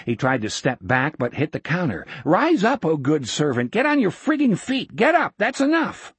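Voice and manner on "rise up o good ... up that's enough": exasperated yell. A narrator's reading. Jack ordering kneeling old man up, laughing yell. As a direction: shout him up, half laughing.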